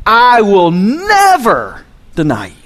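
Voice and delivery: acted-out boastful pledge of loyalty; bold declaration. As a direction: declare it boldly, making a big spectacle. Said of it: "He boldly declared".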